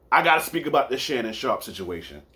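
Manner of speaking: ordinary talk, nothing marked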